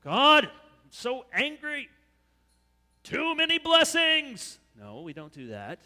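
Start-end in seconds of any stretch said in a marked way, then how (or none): 0.0-4.6 high, loud, slowed fake complaint; mock grumbling. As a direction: ham up a sarcastic whine at God. Mock complaint about too many blessings.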